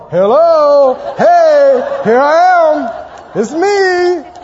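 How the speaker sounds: loud, slow, calling out hello like God himself; loud slowed voice of God saying hello; God answering. Retold or imitated. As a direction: boom out a friendly divine greeting. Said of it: "he's going".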